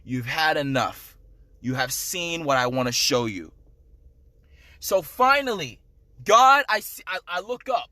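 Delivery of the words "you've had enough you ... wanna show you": deep, hushed and grave, much lower and softer; the Lord's voice. Retold or imitated. The Lord telling him in hell he has had enough, in a deep hushed voice. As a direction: speak down gently as God to his son. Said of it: "The Lord says".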